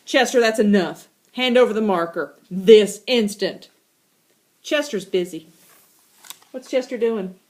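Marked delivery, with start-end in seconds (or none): 0.0-3.7 louder, firm parental order; stern command. Acted. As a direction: lay down the law. Melanie orders the cat.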